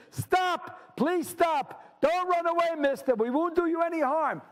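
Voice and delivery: high-pitched pleading call; acted high voice of a woman calling; creature calling out. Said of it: "One of the two creatures calls out".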